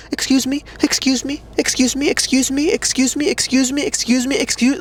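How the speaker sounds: high, thin repeated bleating; imitating a needy guy nagging for attention; mock weak pleading. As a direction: pester from behind, meek and high-pitched. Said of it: "how weak that shit makes you look".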